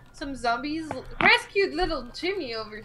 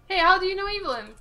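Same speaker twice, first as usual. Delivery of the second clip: higher, louder and quicker, full of eager curiosity; eager in-character greeting; 7 semitones higher, 7 dB louder, faster than usual. Acted. Hero eagerly asks the broody brother about Evelyn, higher and quick. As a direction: bounce up to him all keen.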